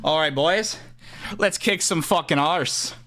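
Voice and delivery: gang leader with a loose Irish lilt; rallying the boys, Irish style; Irish accent. Acted. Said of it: "are you trying to do an Irish accent".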